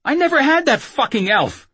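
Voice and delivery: high, loud outburst of helpless rage; feeble rage. Acted. Whoremaster denying he had the elf, feeble rage. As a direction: exclaim it high and furious, with little real power. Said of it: "quivering with feeble rage".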